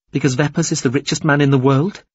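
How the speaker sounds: indignant rising challenge; challenging. Acted. Captive challenges him.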